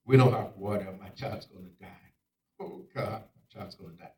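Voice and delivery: low, hushed despair; quiet, understated grief of a mother. Acted. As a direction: let it sink almost to nothing, helpless. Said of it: "And she's crying".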